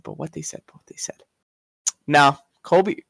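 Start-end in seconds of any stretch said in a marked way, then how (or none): 0.0-0.6 dropping much softer; repeated line falling to near silence; fading softer. Real, not acted. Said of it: "repeats fading out". 0.7-1.2 whispered, fading out; last repeat sinks into a whisper. Real, not acted. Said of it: "repeats fading out".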